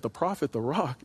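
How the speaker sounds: hushed mock disbelief; incredulous question. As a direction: ask it like you can't believe your ears. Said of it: "You said".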